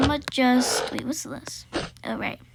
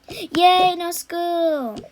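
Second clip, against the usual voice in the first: cheer; 6 semitones higher, 3 dB louder, much slower than usual. Real, not acted. Cheering about the snow day.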